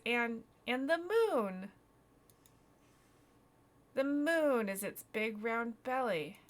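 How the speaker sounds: loud, slow, drawn-out wonder; eager boy. Acted. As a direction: stretch it out as a proud discovery. Voices boy making out moon shape.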